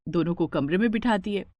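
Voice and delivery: usual speaking voice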